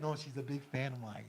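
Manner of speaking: low, hushed reassurance; calm aside. Retold or imitated. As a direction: drop to a low, soft, matter-of-fact reply. Vince's calm reply after screaming.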